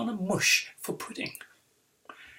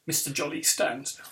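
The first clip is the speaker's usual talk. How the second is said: usual speaking voice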